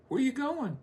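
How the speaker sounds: high-pitched nosy woman's voice; woman asking. Retold or imitated. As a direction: squeak it out like a curious wife. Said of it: "a woman will say".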